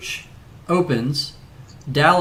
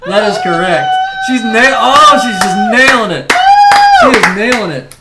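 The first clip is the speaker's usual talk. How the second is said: excited; 7 semitones higher, 9 dB louder than usual. Real, not acted. Excited host, correct answer.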